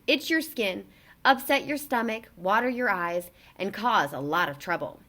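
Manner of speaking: ordinary delivery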